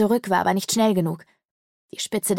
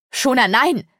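raised, loud protest; alarmed cry; 5 semitones higher, 6 dB louder than usual. A narrator's reading. Mother and daughter call to the maid, alarmed shout. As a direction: call out to stop her. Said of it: "riefen meine Mutter und ich".

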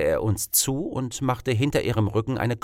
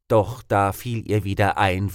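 usual speaking voice

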